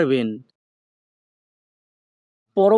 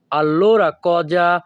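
allora cosa drawn out slowly, each syllable clear; slow it down for learners to echo; careful slow classroom demo; slow teaching pace; much slower than usual. Shown as a demonstration. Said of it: "দুই থেকে তিনবার বলার চেষ্টা করব".

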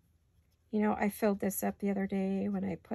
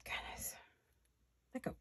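whispered; 14 dB quieter than usual. Real, not acted. Muttered to herself while crafting.

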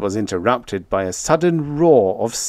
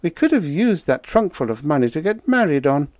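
lightly voiced woman, higher pitch; a hint of a woman's regret, pitched up; regretful woman; 7 semitones higher than usual. A narrator's reading. Irene regretting lost wedding money, lightly voiced.

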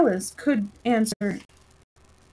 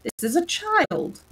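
eager; 8 semitones higher, slower than usual. A narrator's reading. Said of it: "replied eagerly".

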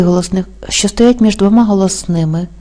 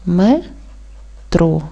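"Метро" split into "Ме", long pause, "тро"; syllable by syllable; 4 dB quieter than usual. Shown as a demonstration. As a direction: say "Ме", stop, then "тро". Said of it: "Правильно поділити на склади буде так". («Метро» по складах: ме — тро)

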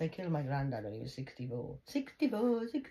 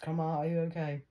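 ordinary delivery

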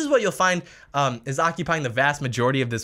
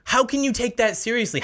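usual speaking voice

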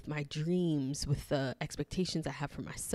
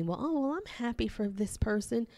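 high, sing-song forced happiness; fake happy; 7 semitones higher than usual. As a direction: chirp it with insincere cheer. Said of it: "other people may say".

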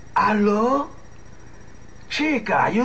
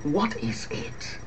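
whispered, slow and hushed; 3 semitones lower, 7 dB quieter, slower than usual. Acted. Boyfriend whispering back so the relatives outside won't hear. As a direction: whisper the question so no one hears.

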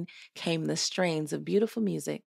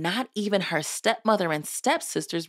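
usual speaking voice

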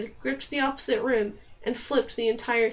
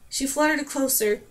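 usual speaking voice

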